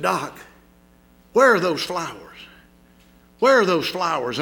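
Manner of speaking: loud pleading repeat of where; desperate plea. A narrator's reading. Injured boy begs the doctor for his flowers, loud and pleading. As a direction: beg loudly for the flowers.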